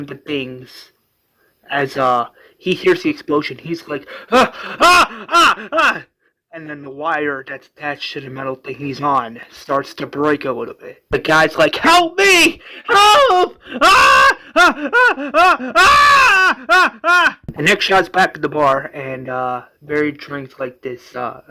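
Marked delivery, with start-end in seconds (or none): 4.3-6.0 short high, loud cries of alarm; panicked yelps. Retold or imitated. Janitor hears explosion nearby, panicked yelps. 11.8-17.4 very high, loud acted screams; screaming for his life. Janitor on breaking wire, screaming for help. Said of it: "the guy screaming for his life".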